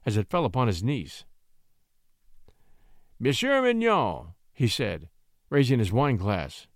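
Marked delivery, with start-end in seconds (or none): 3.2-4.3 high, drawn-out formal address; venomous toast. A narrator's reading. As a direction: address him with mock ceremony. Marquis toasts David with venom.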